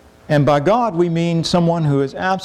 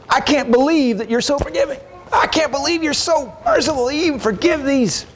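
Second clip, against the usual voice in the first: high, exasperated voice of Jonah complaining; indignant Jonah; 11 semitones higher than usual. Retold or imitated. Preacher voicing Jonah's complaint to God, high and indignant. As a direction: pitch it up high, incredulous and resentful. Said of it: "the way that Jonah looked at this".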